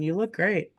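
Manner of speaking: usual speaking voice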